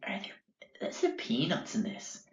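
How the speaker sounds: hushed worried whisper. Retold or imitated. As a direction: keep it secret from the hosts. Stefan secretly asks about peanuts.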